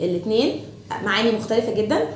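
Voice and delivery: usual speaking voice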